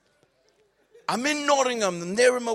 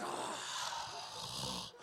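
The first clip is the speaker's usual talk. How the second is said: deflated whisper; 15 dB quieter than usual. Retold or imitated. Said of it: "It's like".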